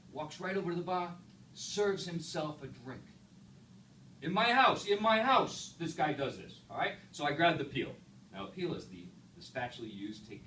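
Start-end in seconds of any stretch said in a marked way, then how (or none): 4.2-4.9 loud, indignant repeat; outraged. Retold or imitated. Pizza man fuming about a stranger at his bar, loud outrage. Outraged owner recounting intruder. 4.9-5.7 loud, indignant repeat; outraged. Retold or imitated. Pizza man fuming about a stranger at his bar, loud outrage. Outraged owner recounting intruder.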